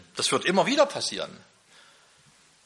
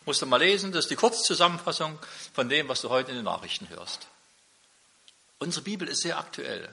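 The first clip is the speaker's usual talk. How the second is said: ordinary talk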